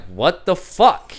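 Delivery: loud, drawn-out disbelief. Retold or imitated.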